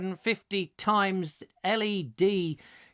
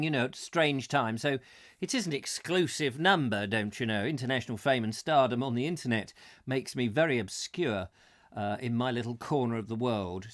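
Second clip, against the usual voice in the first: ordinary talk, nothing marked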